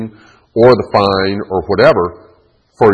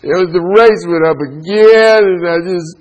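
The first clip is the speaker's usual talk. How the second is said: whining mommy; 10 semitones higher, 4 dB louder than usual. Acted. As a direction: whine like an exaggerated complaining mom. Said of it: "What would it be if Mommy was whining?".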